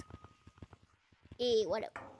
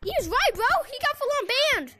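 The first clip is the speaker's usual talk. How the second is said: excited; 6 semitones higher than usual. Real, not acted. Friend banned as predicted.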